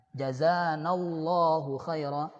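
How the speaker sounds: "Jazanallahu khaira" slow and precisely articulated; careful Arabic phrase. Shown as a demonstration. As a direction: recite "Jazanallahu khaira" slowly, every sound clear. (lafalkan "Jazanallahu khaira" dengan pelan dan jelas)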